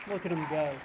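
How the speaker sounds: normal talking voice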